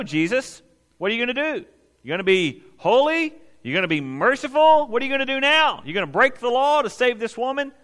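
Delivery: loud, high, taunting questions; a sneering crowd daring Jesus to answer; mocking challenge. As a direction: needle him, sure you've got him cornered. Pharisees challenge Jesus, trap question.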